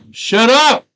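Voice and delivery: very loud, high-pitched yell; sudden full shout to silence someone; explosive interrupting holler. Real, not acted. Breaks off to yell shut up.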